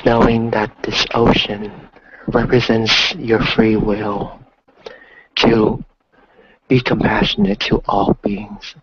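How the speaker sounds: slow, calm meditation voice; guided meditation. Acted. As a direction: guide them gently through the breath. Guided ocean visualization.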